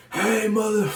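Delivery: high yelled call from across the camp; high-pitched retold yell; screaming. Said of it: "this dude is screaming".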